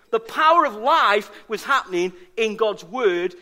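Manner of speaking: usual speaking voice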